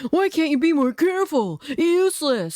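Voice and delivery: high, exasperated mock yell; acted shouting at a careless worker; scolding shout. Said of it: "he always shouts at me".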